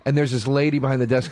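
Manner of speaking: usual speaking voice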